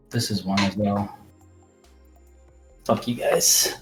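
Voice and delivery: normal talking voice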